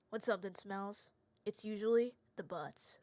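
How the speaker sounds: quiet, low, deadpan and earnest; serious Phoenix. Shown as a demonstration. Phoenix recites the school saying, dead serious. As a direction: say it with a straight face. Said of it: "I have to say this seriously like he would".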